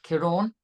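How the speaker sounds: Ciarán with a long AWN ending; corrected pronunciation. Shown as a demonstration. Presenter demonstrating the storm name, Ciarán as kee-RAWN. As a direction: rhyme the end of Ciarán with yawn. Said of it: "a bit like a yawn".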